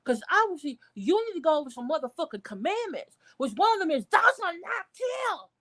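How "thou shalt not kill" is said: shouted commandment. Real, not acted. Reminding the daughter of the commandments, shouting. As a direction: bellow it like scripture.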